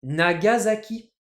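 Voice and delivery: NAGASAKI with a z, loud and slow; French Nagasaki. Shown as a demonstration. Teacher introducing the next example, NAGASAKI slowly with a z. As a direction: announce NAGASAKI as na-ga-za-ki. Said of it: "c'est Nagasaki".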